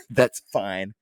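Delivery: low, hushed retold reply; quiet mock resignation. Retelling the friend's answer after the apology, quietly resigned. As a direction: drop it low and soft, as if letting it go.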